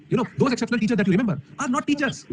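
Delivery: normal talking voice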